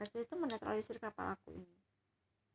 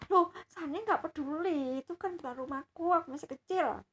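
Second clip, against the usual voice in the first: put-on annoyed child voice; 6 semitones higher, 8 dB louder than usual. Granddaughter insists to grandpa he may buy it. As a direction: whine it like a fed-up kid.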